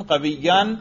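قویین said as "qawiyyan"; accusative ending. Shown as a demonstration. Teacher giving a second example, accusative ending. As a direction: end قویین in "-an" over a doubled y. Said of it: "تینوں طرح کے اعراب لفظی آتے تھے".

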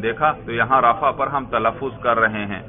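ordinary delivery

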